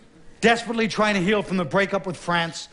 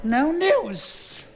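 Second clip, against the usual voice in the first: sudden high squeaky repeat; high falsetto; 9 semitones higher than usual. Acted. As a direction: let the voice jump up high. General repeats, pitch jumps.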